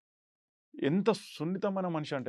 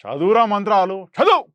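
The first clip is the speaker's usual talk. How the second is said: roaring dialogue; 4 semitones higher, 12 dB louder than usual. Retold or imitated. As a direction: bellow the taunt, every word hammered. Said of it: "first డైలాగే".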